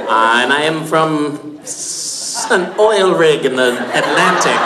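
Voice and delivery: mock Atlantic oil rig accent, slow. Joking he hails from an oil rig, mock accent. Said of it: "classic Atlantic oil rig accent".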